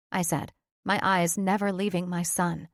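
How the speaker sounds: ordinary delivery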